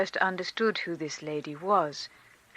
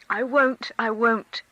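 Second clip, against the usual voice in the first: holding back tears; 4 semitones higher, 5 dB louder than usual. A narrator's reading. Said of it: "gulping down her tears".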